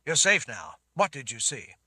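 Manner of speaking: loud, firm man's voice; steady her with a loud, firm command; urgent Richard. Acted. Richard calms panicked Sammy.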